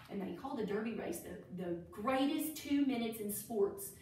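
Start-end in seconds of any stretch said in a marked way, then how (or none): none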